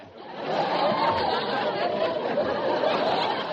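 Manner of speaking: breathy laugh. Real, not acted. Straight man laughs at the Andrews Sisters joke. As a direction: laugh at the mix-up.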